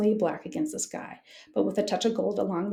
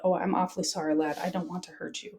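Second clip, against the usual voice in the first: ordinary delivery, nothing marked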